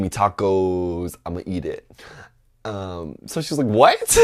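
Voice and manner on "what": very high, loud mock shock; squeaky imitation of a stunned mom; exaggerated incredulous yelp; baffled disbelief. Acts out mom's baffled reaction again.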